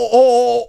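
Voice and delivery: "o" stretched out long and loud; overlong O. Shown as a demonstration. Host trips on Swedish spelling, drawn-out loud "o". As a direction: hold the "o" as if it had too many letters. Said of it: "or too many O's".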